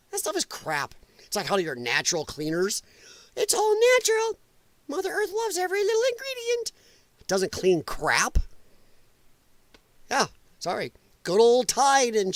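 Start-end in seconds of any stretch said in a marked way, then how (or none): 3.4-6.7 very high, gushing mock voice; mock nature lover. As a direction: parody a natural-products devotee in falsetto. Mocks natural cleaner believers.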